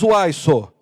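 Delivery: a hint of Minas Gerais drawl; Mineiro accent. Shown as a demonstration. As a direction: toss it out like a Mineiro. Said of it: "Pastor é mineiro".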